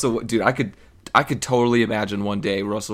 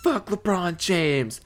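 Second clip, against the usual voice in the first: much higher, put-on mocking girl voice; mocking a tough girl; 8 semitones higher than usual. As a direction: sneer it out in a high bratty voice. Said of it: "she was like".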